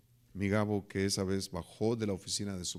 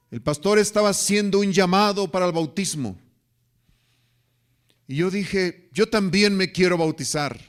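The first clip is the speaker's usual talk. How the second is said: loud, high-pitched preaching climax; preaching at full force; 9 semitones higher, 12 dB louder than usual. Acted. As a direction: belt it out to the congregation. Sermon climax retelling Gabo. (predicando a todo pulmón)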